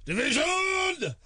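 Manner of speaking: slow, high, stretched call; drawn-out shout. Pretend. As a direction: stretch it like a game show host. Drawn-out game show call.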